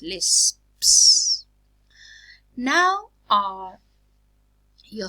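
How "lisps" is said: "lisps" with the final s-p-s drawn out; final cluster sounded out. Shown as a demonstration. Teacher showing a three-consonant cluster, "lisps" slowly sounded out. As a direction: stress each consonant closing "lisps", hissed s at the end. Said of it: "cluster made up of three consonant sounds".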